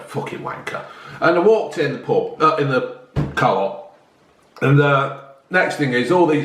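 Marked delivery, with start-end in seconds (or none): 0.0-0.8 whispered curse under the breath; muttered insult. Retold or imitated. Telling off the driver, whispered under his breath. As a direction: hiss it so he won't hear. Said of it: "so I went".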